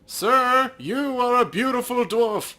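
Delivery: high, loud, oily sales pitch; hammed-up charming salesman; smooth recruiter. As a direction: flatter the dwarf like a cult recruiter. Voicing the recruiter Patrick.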